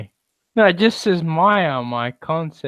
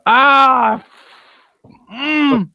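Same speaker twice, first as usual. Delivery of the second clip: sudden high yelp of real pain, then a high strained groan; pained cry; 11 semitones higher, 6 dB louder than usual. Real, not acted. Streamer caught by a leg cramp on stream, real pained yelp. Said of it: "I got a cramp in the leg".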